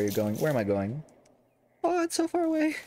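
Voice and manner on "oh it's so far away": high, whining complaint; whiny. Acted. Player whining at the long trek, high whine.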